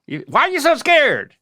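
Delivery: high, loud exasperated scolding dad; mock-scold a scared kid; impatient dad. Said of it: "it's like".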